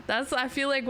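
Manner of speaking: usual speaking voice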